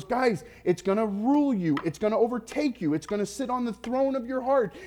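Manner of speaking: high-pitched, urgent mock yell; retold scream. Jesus warns his followers about money, retold scream. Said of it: "He screamed it. He goes".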